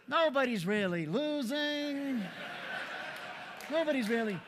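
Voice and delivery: high, slow dreamy voice with a giggle; Chris Farrell impression. Speaker mimics a calm colleague in a slow, high dreamy voice. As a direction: gently float each word. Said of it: "Chris would say".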